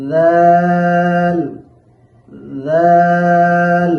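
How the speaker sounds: teacher calling out জাল slowly; drawn-out loud letter demo. Shown as a demonstration. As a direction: hold জাল out strongly like a chant. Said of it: "জিহ্বার আগাটাকে".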